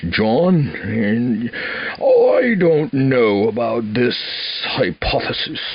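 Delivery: slow, drawn-out professor voice with a changed timbre; imitating professor Barbash. Student imitating his economics professor, slow and drawn out. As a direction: mimic the old professor weighing each word. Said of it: "he would talk like this".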